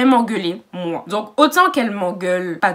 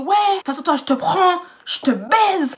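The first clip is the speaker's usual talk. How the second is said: higher mocking voice of the guy; mocking the aggressor; 9 semitones higher, 3 dB louder than usual. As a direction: parody his taunt. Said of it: "elle a commencé à me dire". (imitation moqueuse de l'agresseur)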